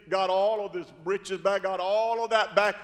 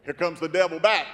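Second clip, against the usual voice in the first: normal talking voice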